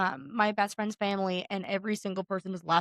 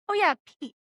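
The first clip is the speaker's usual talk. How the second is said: a light, breezy higher take on the guy; casual guy voice; 8 semitones higher than usual. Retold or imitated. To a confused girl, a casual dude naming his friend. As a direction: toss off the name like everyone knows him.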